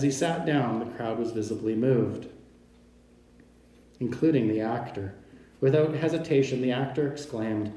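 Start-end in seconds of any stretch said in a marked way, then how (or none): none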